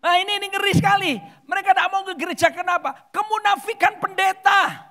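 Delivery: high, loud preacher's outcry; alarmed shout. Real, not acted. Preacher exposing clergy hypocrisy to the congregation, alarmed shout. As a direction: cry it out in high-pitched alarm.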